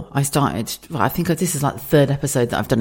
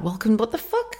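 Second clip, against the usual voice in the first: mock baffled; 7 semitones higher, 5 dB quieter than usual. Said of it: "you'll go like".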